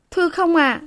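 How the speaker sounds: high, polite maid's voice; respectful young maid. A narrator's reading. Maid politely answers her mistress, high voice.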